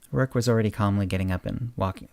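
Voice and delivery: ordinary delivery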